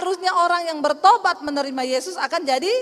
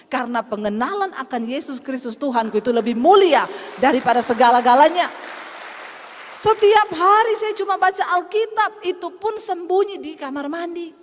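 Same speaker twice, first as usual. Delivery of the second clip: louder, building preaching; 6 dB louder than usual. Acted. Preacher proclaims knowing Jesus above everything, building loud. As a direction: swell up to a loud proclaiming peak.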